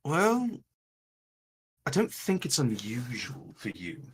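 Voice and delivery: lightly voiced game character, pausing between phrases; a hint of an in-character voice, thinking it through; Raylan, measured and reassuring. Raylan answers Evangeline about what is happening to her, lightly voiced and measured.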